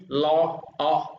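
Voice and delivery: "lo", "o" as separate syllables; counting syllables. Shown as a demonstration. Teacher shows students the third-last syllable, "lo, o" separated. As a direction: count back through "lo", then "o", each on its own. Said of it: "âm tiết thứ ba thì lên".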